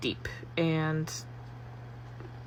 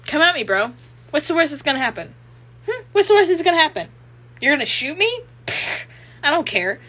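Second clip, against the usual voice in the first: loud, high, cocky challenge; reckless dare; 8 semitones higher, 7 dB louder, faster than usual. Acted. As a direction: goad an attacker as if nothing matters. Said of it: "that energy".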